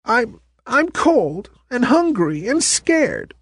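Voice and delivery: scared. Acted. Lost toy stammers in fear.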